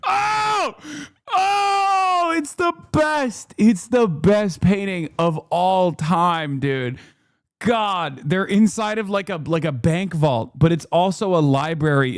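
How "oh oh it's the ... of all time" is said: high squealing "Oh!" then slow gushing praise; ecstatic cry. Real, not acted. Host seeing the painting on screen, squealing with delight.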